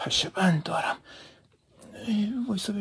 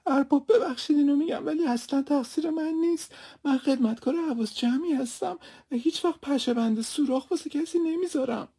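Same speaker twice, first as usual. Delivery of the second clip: tearful pleading; 8 semitones higher than usual. Acted. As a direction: cry out your defence to the master.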